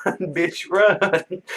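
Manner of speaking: high, thin imitation of screaming; strained high-pitched mock cheer at a racehorse. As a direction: scream it in mockery without full volume. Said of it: "literally screaming".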